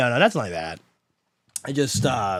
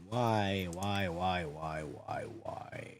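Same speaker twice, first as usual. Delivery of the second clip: low, fading "why" over and over; dejected muttering; 6 semitones lower, 13 dB quieter, much slower than usual. Real, not acted. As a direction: trail off into a defeated whisper. Stream software keeps failing.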